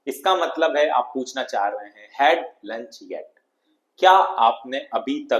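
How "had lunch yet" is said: slow model reading. Shown as a demonstration. Teacher repeats the model.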